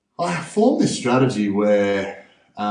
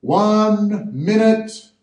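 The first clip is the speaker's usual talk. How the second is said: much higher, louder, very slow drawn-out call; countdown call; 12 semitones higher, 5 dB louder, much slower than usual. Retold or imitated. Safety official calling the countdown to the diver: slow, loud call. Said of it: "they go".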